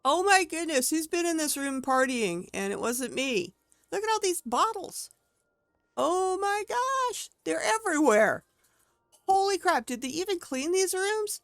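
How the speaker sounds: high, loud shocked outburst; finding beer bottles everywhere in the room, shocked and loud; shocked disbelief. Real, not acted. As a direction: react to the mess, genuinely appalled.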